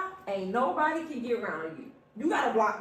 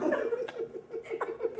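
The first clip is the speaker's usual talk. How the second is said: squealing burst of laughter; high laugh; 12 semitones higher, 4 dB quieter than usual. Real, not acted. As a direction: crack up high. Laughing at juvenile.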